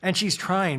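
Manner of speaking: normal talking voice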